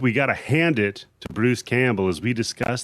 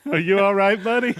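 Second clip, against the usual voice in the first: laughing; 9 semitones higher, 5 dB louder than usual. Real, not acted. Laughing at hiccup scare.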